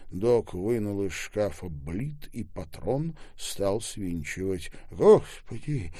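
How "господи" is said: usual speaking voice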